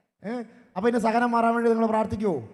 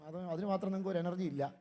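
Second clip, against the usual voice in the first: ordinary delivery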